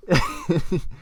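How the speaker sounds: a high, breathy sneaky giggle; ominous giggling. Real, not acted. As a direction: giggle like you're hiding a secret. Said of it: "Ominous giggling".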